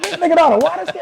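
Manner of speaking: mock excited cheer. Friends cheering him on.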